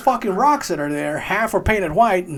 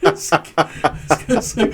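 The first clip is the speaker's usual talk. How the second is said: ordinary talk, nothing marked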